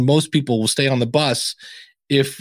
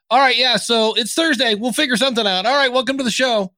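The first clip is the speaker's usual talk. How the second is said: clueless host; 8 semitones higher than usual. Retold or imitated. Mocking a clueless podcast host.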